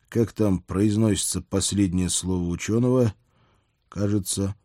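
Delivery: ordinary delivery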